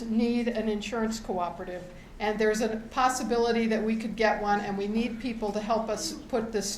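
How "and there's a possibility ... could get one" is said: ordinary delivery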